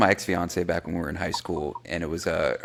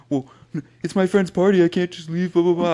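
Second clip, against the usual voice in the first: put-on high, whiny girlfriend voice; mocking ex-fiance; 10 semitones higher, 3 dB louder than usual. Teen mocking his ex refusing to leave the party, whiny and high. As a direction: mimic her excuses, much higher. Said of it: "She's like".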